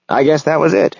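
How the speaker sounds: lightly cheerful, pitched well up. A narrator's reading. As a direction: say it with a touch of good cheer. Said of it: "he says cheerfully".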